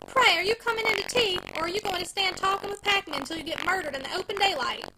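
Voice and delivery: high, loud, severe scolding. Acted. Wife scolds husband for talking with packmen, severe and loud. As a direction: rebuke him sharply from afar.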